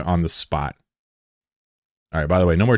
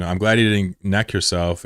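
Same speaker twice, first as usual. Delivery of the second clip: ordinary delivery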